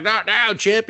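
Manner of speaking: shrill mock brush-off; high mock annoyance. Hero brushing off his chip's suggestion, high mock annoyance.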